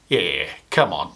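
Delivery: low, slow, knowing cop drawl; deep mocking cop. Cop to the puppy thief at his door, low and slow. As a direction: play the unimpressed policeman, dragging it out.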